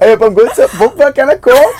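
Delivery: laughing, high and loud Yorkshire A up; hammed-up Yorkshire, laughing; over-the-top Yorkshire A up, pub and Coke; exaggerated Yorkshire. Shown as a demonstration. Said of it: "teach you how to ... in Yorkshire".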